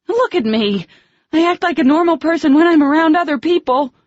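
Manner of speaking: high, loud exasperated shouting; furious rant. Acted. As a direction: rail at him and at herself. Slapping water in anger.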